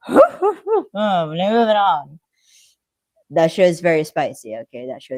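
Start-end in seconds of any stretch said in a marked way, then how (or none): 0.1-2.2 awkward brush-off. Pretend. Mock awkward dodge of spicy topic.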